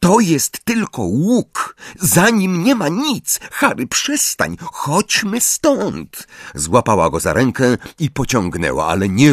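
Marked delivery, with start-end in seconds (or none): 0.0-6.3 high, drawn-out nervous insistence; upset girl urging him to stop; agitated. A narrator's reading. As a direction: insist and plead in a raised pitch. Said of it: "bardziej zdenerwowana, niż wynikałoby".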